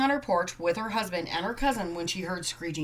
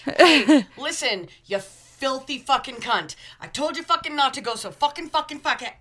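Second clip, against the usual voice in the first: angry rant; 6 semitones higher, 7 dB louder than usual. Retold or imitated. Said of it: "the F words that would come out of my mouth".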